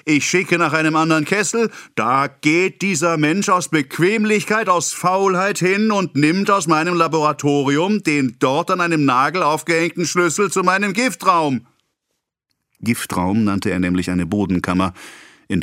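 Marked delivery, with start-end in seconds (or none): 0.1-11.7 lightly raised, fussy pharmacist voice; a hint of the affronted apothecary; indignant complaint. A narrator's reading. Pharmacist grumbling about his apprentice, lightly raised and indignant.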